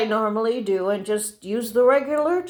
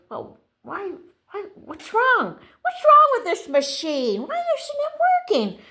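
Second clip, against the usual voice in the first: high-pitched, puzzled self-talk; acted exasperated bewilderment; baffled complaint; 11 semitones higher than usual. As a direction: fret at a stubborn machine. Retelling puzzlement at failing machine.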